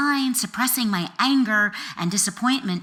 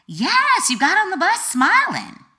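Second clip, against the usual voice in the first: much higher, louder insistent friend's voice; Cece insisting; 12 semitones higher, 7 dB louder than usual. Retold or imitated. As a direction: insist like a friend who saw it all. Friend Cece answers on bus.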